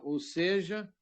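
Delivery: ordinary talk, nothing marked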